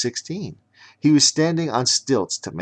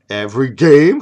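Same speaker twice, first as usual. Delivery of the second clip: surprised question; 5 semitones higher, 12 dB louder than usual. Acted.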